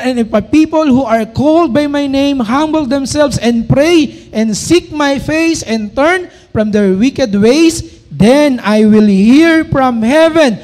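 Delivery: slow, loud, solemn verse reading; scripture reading. Acted. Preacher proclaiming a verse to the congregation, slow and loud. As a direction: proclaim each word of the verse slowly.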